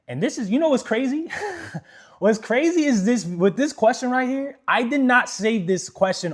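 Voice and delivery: loud, high, thrilled; bursting with excitement; excited. Real, not acted. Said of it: "excited about the question".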